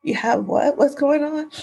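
high, puzzled questions; surprised mother. Retold or imitated. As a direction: ask it in disbelief. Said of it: "she's like".

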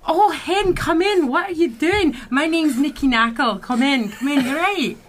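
high, lilting Scottish welcome; Scottish accent. Acted. Scottish tavern keeper welcoming the wounded in, Scottish accent. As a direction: play a Scottish tavern keeper calling them in. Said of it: "I'm doing a Scottish accent".

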